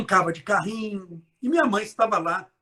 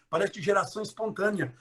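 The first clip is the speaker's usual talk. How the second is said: ordinary talk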